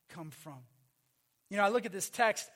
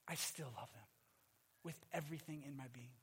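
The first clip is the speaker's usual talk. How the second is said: much softer and lower, sincere; tender hush; 9 semitones lower, 18 dB quieter than usual. Acted. Pastor telling parents he still loves his kids, tender and soft. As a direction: let it fall to a gentle near-murmur. Father's love despite anger.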